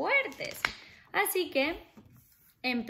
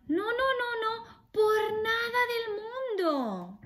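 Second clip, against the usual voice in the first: much higher pleading piggy voice, half sung; squeaky little pig; 11 semitones higher than usual. Acted. Little pig refusing the wolf at the door, high and sing-song. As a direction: squeal it out in a tiny frightened voice. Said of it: "Los cerditos dijeron".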